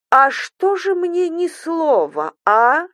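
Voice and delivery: high, drawn-out sarcastic question; mock-wondering, slow and high; menacing demand. A narrator's reading. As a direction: needle her slowly, ending on a sharp query. Mother-in-law demands answer.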